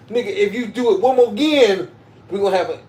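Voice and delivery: loud, high scolding of a drooling buddy; mock yell at friend. Yelling at drooling best friend, mock angry. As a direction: bark it at your best friend, half laughing.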